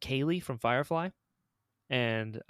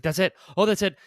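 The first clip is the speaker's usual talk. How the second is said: louder, excited re-enacted discovery; retold eureka moment; 5 semitones higher, 7 dB louder, faster than usual. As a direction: act out finally finding the right voice. Retelling discovery of the right voice.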